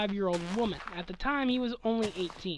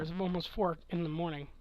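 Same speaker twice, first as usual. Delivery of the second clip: ordinary talk, nothing marked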